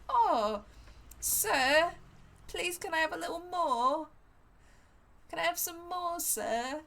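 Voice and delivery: high, loud pleading Cockney urchin voice; Oliver Twist Cockney. Pretend. Streamer showing off an Oliver Twist Cockney accent. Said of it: "My Cockney accent is very Oliver Twist".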